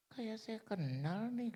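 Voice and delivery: high, slow, wondering voice; curious old lady. Retold or imitated. Old lady in the lift half-recognizing the preacher, high slow wondering. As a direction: squint and wonder who he is.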